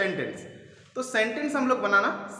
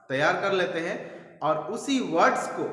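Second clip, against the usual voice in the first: ordinary delivery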